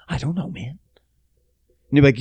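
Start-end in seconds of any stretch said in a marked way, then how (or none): 0.1-0.8 hushed reply; whisper. Retold or imitated. Co-host whispering back to the host, hushed. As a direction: breathe it under the table. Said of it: "And I'm like".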